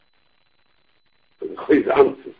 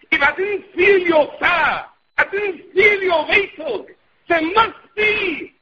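fervent proclamation; 8 semitones higher than usual. Acted. Eli tells the crying woman she is blessed, in a slow fervent near-shout. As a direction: declare it with rising fire.

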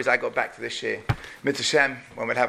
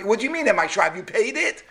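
exasperated shopkeeper; 7 semitones higher, 5 dB louder than usual. Acted. Shopkeeper snaps at the customer.

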